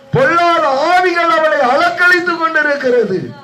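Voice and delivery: very high, sustained preaching cry; fervent warning. Real, not acted. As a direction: sound the alarm to the congregation. (எச்சரிக்கும் உயர்ந்த பிரசங்கக் குரல்)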